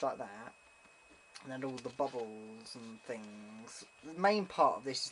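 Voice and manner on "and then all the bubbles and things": ordinary talk, nothing marked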